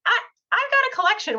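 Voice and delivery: high, quick, eager offer. Retold or imitated. Client offering Cindy his collection, high and quick.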